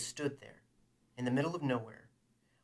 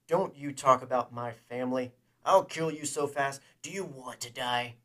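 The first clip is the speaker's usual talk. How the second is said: loud, furious threat; fury; 9 dB louder than usual. A narrator's reading. Man warning his enemy off his family, furious. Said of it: "The fury that touched Han".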